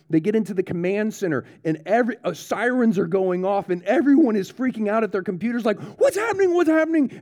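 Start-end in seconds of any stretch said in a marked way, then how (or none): 6.0-7.2 high frantic repeated question; panicked cry. Retold or imitated. Soldiers at computers asking what's happening, high panicked cry. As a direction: cry out in panic as alarms blare.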